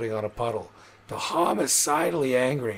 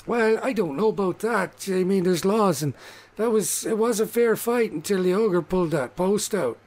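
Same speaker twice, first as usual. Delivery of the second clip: higher, louder halfling objecting; defensive halfling; 7 semitones higher, 4 dB louder than usual. Acted. Halfling defends Magma's fight to the rogue, higher and louder. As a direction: push back on the grumbler.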